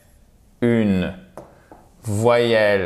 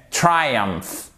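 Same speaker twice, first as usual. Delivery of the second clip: "Triumph" as "TRY-umf"; stress on first syllable. Shown as a demonstration. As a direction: lean on the TRI of "Triumph". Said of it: "Accent tonique sur la première syllabe".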